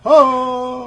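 loud, high, drawn-out "oh"; open-mouthed "oh". Acted. Imitating the toy's open-mouthed face, a loud mock "oh". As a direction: call out a big mock "oh". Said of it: "Are you saying".

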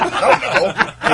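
slow, airy, laughing; breathy laughing. Retold or imitated. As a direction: laugh it out slowly. Drunk reply, laughing.

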